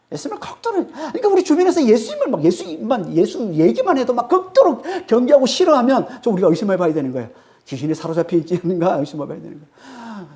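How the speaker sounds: ordinary talk, nothing marked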